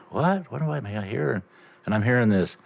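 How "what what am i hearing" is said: high-pitched baffled questioning; startled puzzlement. Retold or imitated. Shopper hearing a strange noise in the store, startled. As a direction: pitch it up high, puzzled at what you hear.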